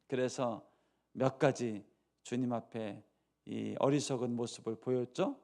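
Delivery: usual speaking voice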